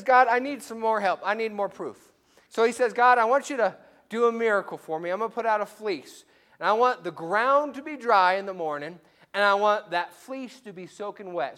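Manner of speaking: loud, high insistent plea for proof; demanding Gideon. Acted. Gideon demanding a fleece miracle from God, loud and insistent.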